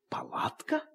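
barely voiced, whispered query; puzzled whisper; hushed question. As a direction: repeat it almost without sound. Father taken aback by tent.

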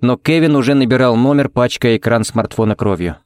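plain reading voice

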